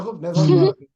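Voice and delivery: loud, exasperated mock scolding; mimicked teacher's scolding. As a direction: imitate an irritated teacher telling off a pupil.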